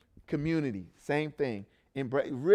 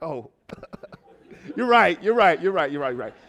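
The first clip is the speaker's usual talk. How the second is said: laughing agreement; 3 semitones higher, 9 dB louder than usual. Retold or imitated. Caught out, laughing at himself.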